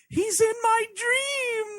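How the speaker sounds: high, drawn-out mock wail. Mocking a tired horror dream cliché, wailing. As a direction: stretch it out as a hammed-up lament.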